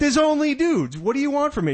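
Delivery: high-pitched, exasperated protest. Acted. God exasperated with Adam's request.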